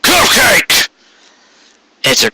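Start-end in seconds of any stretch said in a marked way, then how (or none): 0.0-0.9 sudden outburst. Acted. Quarry bursts out at Carrot.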